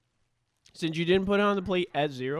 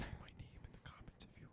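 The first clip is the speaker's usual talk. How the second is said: breathy secret whisper to the mic; giggly prank whisper; 27 dB quieter than usual. Real, not acted. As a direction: whisper it like a cheeky confession. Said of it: "Why are you whispering into the mic?".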